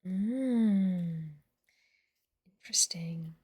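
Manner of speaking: soft unvoiced note-taking whisper. Real, not acted. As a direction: whisper it to yourself. Whispering while taking notes.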